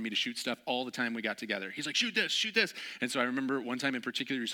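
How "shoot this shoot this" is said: high, pushy begging; eager friend. Retold or imitated. Eager friend begging the shooter, high and excited. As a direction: urge him to shoot again.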